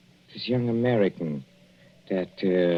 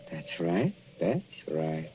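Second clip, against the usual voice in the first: lower, softer, slow reassurance with menace; low soothing; 7 semitones lower, 8 dB quieter, slower than usual. Acted. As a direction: coo it down like a lullaby. Husband confirms coroner, quietly.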